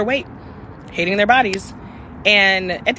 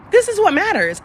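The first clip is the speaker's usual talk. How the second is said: emphatic realization; 11 semitones higher, 4 dB louder than usual. Real, not acted.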